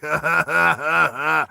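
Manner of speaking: big mocking belly laugh; gnarly villain laugh. Russian taunts Roy's character, gnarly mocking laugh. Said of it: "This big, dirty, gnarly guy".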